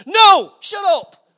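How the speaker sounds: sudden loud, high mock retort; exasperated shout. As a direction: cut the preacher off with a shout. Acted retort to the mocked preacher.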